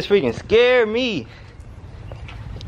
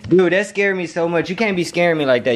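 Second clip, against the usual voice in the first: ordinary talk, nothing marked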